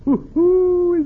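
a loud, high hoot; delighted hoot. Acted. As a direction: crow with glee at the chance. Pasquale crows, delighted.

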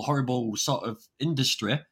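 ordinary talk

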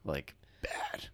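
hushed whisper. Real, not acted. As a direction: breathe it out in a whisper. Whispered follow-up.

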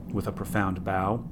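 plain reading voice

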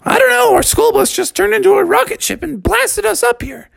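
put-on very high kid voice. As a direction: explain it high and excitable. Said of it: "all the kids said".

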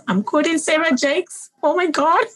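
high-pitched, laughing disbelief. Real, not acted. Host catches herself quoting a preacher, laughing. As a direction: crack up while talking. Guest cracks up at own quote.

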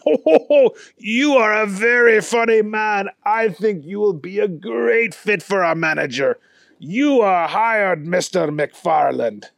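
jolly French host hiring him; hammed-up French accent. A narrator's reading. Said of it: "Claude's accent".

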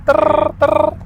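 put-on high rolling trrr of an old dial-up modem; modem screech. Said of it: "ya hacía el trrr". (imitando el chirrido agudo del módem)

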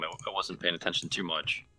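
ordinary talk, nothing marked

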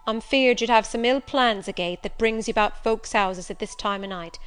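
loud, forceful warning from the doorstep; suspicious servant, warning. Acted. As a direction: warn off a suspected housebreaker. Suspicious servant warns off stranger.

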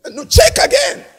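high, loud mock disbelief; panicked protest. Rejected soul protesting to the angel, high panicked shout. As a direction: shriek that the angel must look again.

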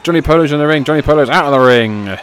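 building to loud excited calls; wrestling commentator. Acted. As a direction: hype the finish like a ringside announcer. Live wrestling play-by-play.

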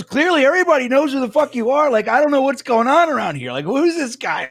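high-pitched imitation of a confused man; bewildered stranger. Stranger in line puzzled by the fans, imitated bewilderment. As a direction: mimic a stranger's exasperated puzzlement. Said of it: "He goes".

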